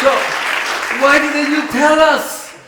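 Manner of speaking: loud, high, exasperated demand; indignant question. Retold or imitated. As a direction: throw the question at them in disbelief. Italians confront the locals.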